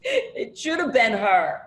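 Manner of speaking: said through a laugh, slow; can't help laughing at the joke. Real, not acted. Sister laughing at brother's impression, slow laughing.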